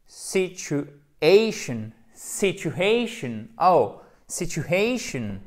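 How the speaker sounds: English "Situation" said slowly, stressed on the third syllable; démonstration de prononciation. Shown as a demonstration. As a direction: draw out "Situation" syllable by syllable, falling at the end. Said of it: "la phonétique vous permet de précisément prononcer". (prononciation anglaise modèle, lente et articulée)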